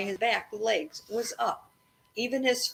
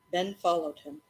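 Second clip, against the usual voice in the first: usual speaking voice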